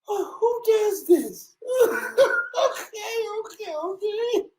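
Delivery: high, breaking into laughter; laughing high outcry. Real, not acted. Speaker laughs at own joke, high laughing. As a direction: squeal it while laughing. Cracks up again.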